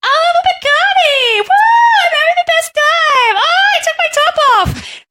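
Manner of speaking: put-on shrieking party girl, very high and loud; woo girl. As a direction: whoop it up like a drunk party girl. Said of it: "the woo girl drink".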